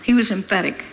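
ordinary delivery

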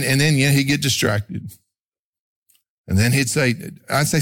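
ordinary talk

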